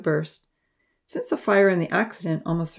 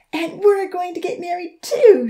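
much higher, louder joyful cheer; 12 semitones higher, 8 dB louder than usual. A narrator's reading. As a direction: cheer out the happy news. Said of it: "she cheered".

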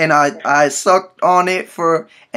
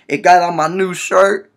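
usual speaking voice

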